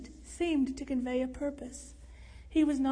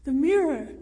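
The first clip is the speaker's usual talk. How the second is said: loud, startled cry; gasped exclamation; 8 dB louder than usual. A narrator's reading. Jordan seeing the mirror before the plunge, gasped exclamation. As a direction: blurt it out in sudden shock. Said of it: "when Jordan gasped".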